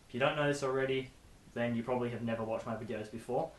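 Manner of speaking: ordinary delivery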